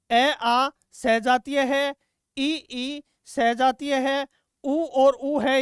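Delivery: slow, each vowel ए आ and ई ई said separately; slow spelling out. Shown as a demonstration. Teacher dictating vowel pairs to students, slowly. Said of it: "teacher spells out vowel pairs".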